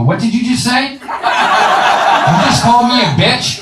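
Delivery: high, slow outraged woman's voice; indignant woman. Retold or imitated. Wife demanding if he called her a bitch, high outraged voice. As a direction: play the offended girlfriend, drawn out. Woman offended by husband's insult.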